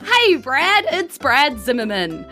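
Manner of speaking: usual speaking voice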